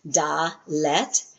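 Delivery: dalet split in two, a gap between da and let; syllable by syllable. Shown as a demonstration. Teacher showing the class the word's two syllables, syllable by syllable. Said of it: "two syllables".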